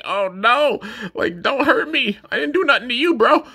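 high pleading toy voice; scared little creature voice. Pretend. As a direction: voice the toy begging for mercy.

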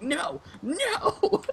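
high, laughing cry of no; mock anguished no. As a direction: wail it through laughter. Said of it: "I was like".

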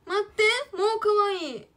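loud, high burst of delight; a loud, high gush over her own drawing; excited gush. Real, not acted. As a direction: squeal it out as the cuteness hits.

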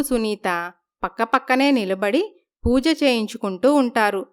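ordinary talk, nothing marked

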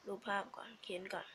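very soft, whispered aside; muttering to self. Real, not acted. Teacher talking to herself while writing, whispering. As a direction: murmur it under your breath.

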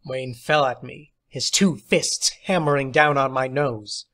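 plain reading voice

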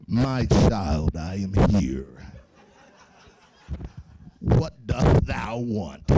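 very low, slow, loud booming God voice; deep voice of God. Pretend. God answering the teen from the clouds, deep, slow and booming. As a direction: boom it like a movie God in King James English. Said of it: "got that deep voice".

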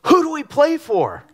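high-pitched rallying shout; retold sideline yell. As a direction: call it out to a downcast teammate. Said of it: "I just yelled to him".

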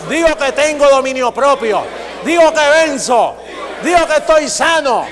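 slow, loud proclamation building to a shout; preaching declaration. Shown as a demonstration. Preacher shows the church how to confess the word, rising to a shout. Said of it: "Diga, hay que confesar la palabra".

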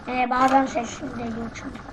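normal talking voice

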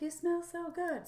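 high-pitched imitation of admirers; gushing compliment. People who hug her praising her scent, gushing. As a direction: mimic a gushing hugger, voice lifted high.

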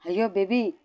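first call, mildly alarmed; a hint of panic setting in; startled concern; worried. A narrator's reading. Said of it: "అంటూ కంగారు పడిపోతుంది".

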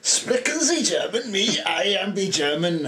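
high-pitched, badly faked German accent. Player told to do it after protesting, high mock German accent. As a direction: put on a silly, hammed-up German accent. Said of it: "where's your German accent?".

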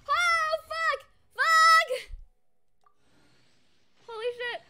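high, loud, screamed swearing; swearing tirade. Real, not acted. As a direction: yell the curses, overwhelmed.

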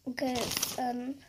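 real upset, softly holding back tears; small, upset voice with halting pauses; about to cry. Real, not acted. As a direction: speak softly, close to crying. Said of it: "Sorry, I'm gonna cry".

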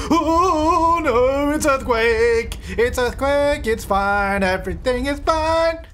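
high, loud, slow mock alarm; mock panic. Gamer watching an earthquake hit her sims, mock panic. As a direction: ham up the panic, then pretend all is fine.

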